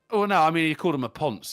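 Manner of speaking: usual speaking voice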